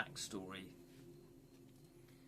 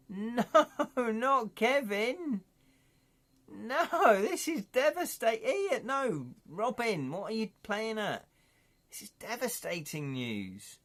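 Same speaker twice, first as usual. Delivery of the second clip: high hammed-up protest, pitched up; mock distraught cry, not Kevin; mock anguish; 9 semitones higher, 4 dB louder than usual. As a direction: wail over a fallen friend in jest. Mock distress over character death.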